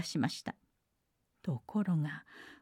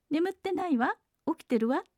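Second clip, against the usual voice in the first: shout; 9 semitones higher than usual. Acted. Mameko shouts to the monster that she is awake, high and bold. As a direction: call it out high, proud and cheeky. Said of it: "と豆子は大声で叫びました".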